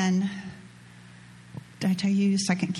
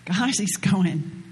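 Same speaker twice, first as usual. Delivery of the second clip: ordinary talk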